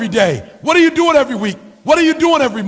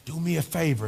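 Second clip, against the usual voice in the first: ordinary delivery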